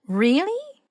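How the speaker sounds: high, sharp astonished question; boggled disbelief. A narrator's reading. Hermione stunned at F's claim, high astonished query. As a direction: leap up in disbelief. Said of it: "Hermione boggled".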